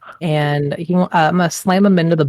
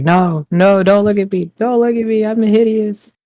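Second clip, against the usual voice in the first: melodramatic anguish; 5 semitones higher than usual. Acted. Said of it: "I'll be like".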